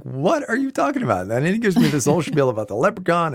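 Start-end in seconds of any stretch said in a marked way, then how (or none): 0.0-1.2 incredulous, much higher pitch; baffled. Retold or imitated. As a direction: play the confused dad, voice jumping way up. Dad's retold baffled reply.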